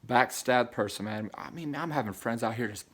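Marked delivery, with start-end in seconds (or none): none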